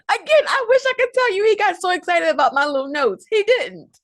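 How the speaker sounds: high, amused aside; rueful high chuckle in the voice; wry amusement. Real, not acted. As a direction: laugh it off as you admit it. Rueful joke to audience.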